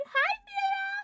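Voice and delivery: very high, loud hello; piercingly high excited hello; squealed greeting. Pretend.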